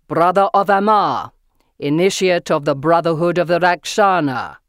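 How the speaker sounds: loud, commanding proclamation; deep powerful voice. A narrator's reading. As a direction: announce it like a stern tribunal. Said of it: "deep and powerful".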